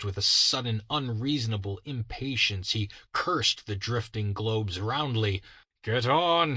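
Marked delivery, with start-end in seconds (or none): 5.8-6.6 high, loud command; cried out. Acted. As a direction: urge the horses on. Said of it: "he cried".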